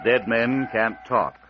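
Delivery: ordinary delivery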